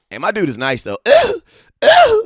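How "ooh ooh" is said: squealed ooh, way up high; high excited ooh. Real, not acted. Watching the slam on film, high excited ooh.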